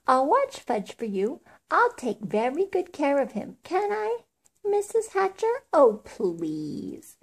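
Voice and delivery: put-on eager high-pitched girl pleading; begging. A narrator's reading. Girl offering to watch a little boy, eager begging. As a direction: beg to be allowed, pitch climbing before the final plea. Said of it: "begged some more".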